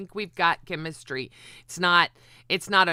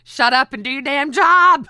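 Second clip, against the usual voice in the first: shouting; 7 semitones higher, 10 dB louder than usual. Real, not acted. Ranting at a lazy host.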